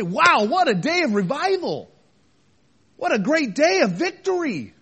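high, rejoicing exclamation; elated church member. Acted. Church member reacting to the miracle, elated. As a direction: celebrate a great win with a lifted voice. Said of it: "any pastor or any church member would say".

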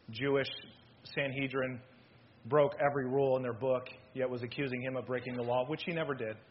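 ordinary talk

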